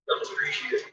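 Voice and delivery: whispered repeat, no voice. Shown as a demonstration. Whispering the point again to the dog. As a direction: breathe it out as a whisper. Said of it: "She doesn't appreciate it".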